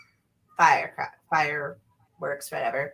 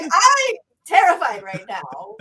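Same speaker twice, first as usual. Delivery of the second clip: higher, slow, hammed-up fright; mock terrified; 10 semitones higher, 5 dB louder, slower than usual. Joking to friends about facing the mummy, mock terrified. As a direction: pretend to be scared of the mummy. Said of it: "I'm like".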